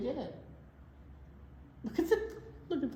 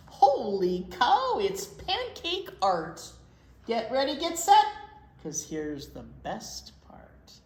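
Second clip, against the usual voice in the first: bouncy sing-song storybook reading; reading to children; 2 semitones higher, 5 dB louder than usual. Acted. Librarian reading pancake rhymes to kids, sing-song. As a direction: read the rhyme aloud to little kids. Reads rhyming picture book aloud.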